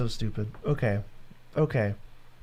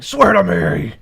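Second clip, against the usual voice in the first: Batman voice; 4 semitones higher, 10 dB louder, much slower than usual. Shown as a demonstration. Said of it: "and then you can say, like".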